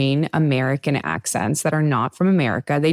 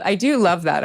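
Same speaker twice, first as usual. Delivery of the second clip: normal talking voice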